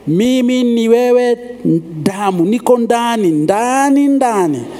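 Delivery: drawn-out, swelling Swahili declaration; mock avowal of loyalty. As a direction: stretch each word in a hammed-up pledge.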